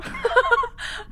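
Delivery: real laughter breaking up, high; helpless high laugh. Real, not acted. Host cracking up at her own story, high helpless laugh. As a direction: laugh until it squeaks.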